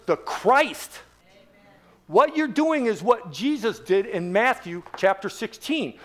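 ordinary talk

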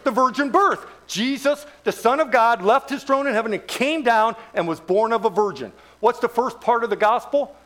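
ordinary talk

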